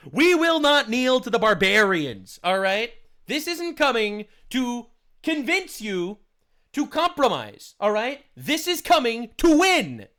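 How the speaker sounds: slow, loud rally speech in a put-on British accent; mock British accent. Mocking a British nationalist to viewers in a slow, loud British accent. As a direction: thunder it like a British nationalist leader. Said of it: "I say we like I'm British".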